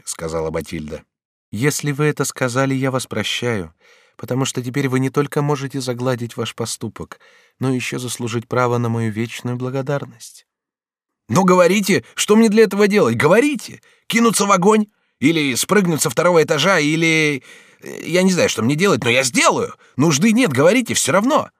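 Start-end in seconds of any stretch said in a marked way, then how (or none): 1.5-10.5 higher, gentle heroine voice; forgiving Batilda. Acted. Batilda forgives her friend's misdeed, forgiving. 11.3-21.5 very high, loud, impassioned pleading; eager Bonifas. Acted. Bonifas offers to leap into fire for Batilda, eager.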